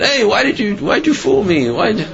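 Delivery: high, urgent call after him. Acted. Man chasing his benefactor to thank him, calling out urgently. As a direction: call after him, half accusing, half amazed.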